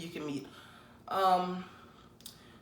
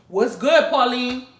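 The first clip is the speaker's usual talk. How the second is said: louder call of "What's good, Pauline?"; 4 semitones higher, 8 dB louder, slower than usual. Acted. Greeting grandmother Pauline, calling out louder. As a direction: call her name across the room.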